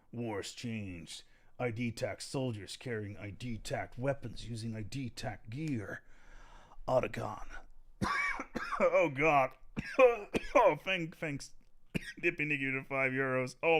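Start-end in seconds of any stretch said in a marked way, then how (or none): 0.1-6.0 lightly done gravelly soldier growl; Solid Snake voice. Pretend. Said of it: "Doing the Solid Snake voice". 6.9-7.5 Solid Snake voice. Pretend. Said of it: "Doing the Solid Snake voice". 7.5-8.7 strained cough after the growl; hacking cough, throat sore. Real, not acted. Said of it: "not good for my voice box". 9.5-10.5 rough cough from the strained voice; hacking cough, throat raw. Real, not acted. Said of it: "not good for my voice box".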